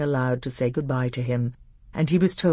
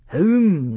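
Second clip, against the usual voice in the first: "Whom?" loud, higher and drawn out; corrective stress; 4 semitones higher, 8 dB louder than usual. A narrator's reading. Tutor correcting the prince's grammar, emphatic "Whom?". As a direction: correct the pupil with a heavy, raised "Whom?".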